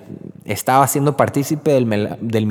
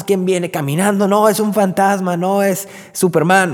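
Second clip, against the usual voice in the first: mock frightened disciples; 8 semitones higher than usual. Acting frightened disciples in the boat.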